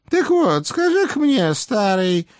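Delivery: put-on high nagging old hag; old witch voice. A narrator's reading. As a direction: scold like a fussy old witch. Baba Yaga voiced by reader.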